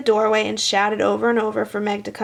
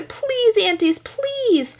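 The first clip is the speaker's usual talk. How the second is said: high, slow drawn-out plea; begging children; 11 semitones higher, much slower than usual. A narrator's reading. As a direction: stretch it like kids begging. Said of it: "begged Meg and Sean together".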